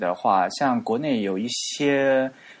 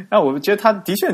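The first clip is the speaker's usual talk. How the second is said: laughing; 9 semitones higher, 6 dB louder than usual. Real, not acted. Amused after teasing.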